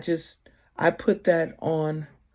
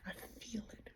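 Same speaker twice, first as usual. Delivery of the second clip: breathy whisper, almost no voice; whispered I feel it; 3 semitones higher, 25 dB quieter, faster than usual. Retold or imitated. Telling loved ones she feels their love, whispered. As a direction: breathe it like a secret prayer.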